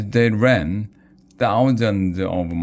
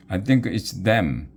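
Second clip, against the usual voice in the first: usual speaking voice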